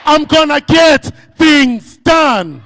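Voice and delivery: high, slow, loud rallying pledge; shouted pledge. Shown as a demonstration. Speaker leads the audience in a final pledge to the bank, shouting. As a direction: shout the promise as a battle cry. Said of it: "Let's say it one more time".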